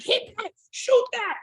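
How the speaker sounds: high yelled coaching from the stands; courtside yelling. Retold or imitated. Dad at his boys' game, high mock yell. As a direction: yell at your son on the court. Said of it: "I'm screaming".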